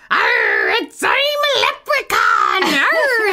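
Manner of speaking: pirate voice. Retold or imitated. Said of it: "Kyle just kept".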